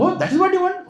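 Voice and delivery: very high amused surprise; be surprised it is so little; happy surprise. Acted. Father surprised at request.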